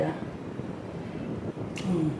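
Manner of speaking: ordinary delivery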